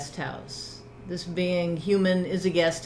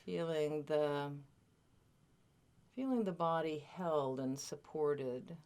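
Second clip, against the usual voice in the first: guided meditation; 2 semitones lower, 12 dB quieter than usual. Real, not acted. Teacher settling a group into body awareness, guided meditation. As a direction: gently lead listeners into stillness, leaving space between phrases.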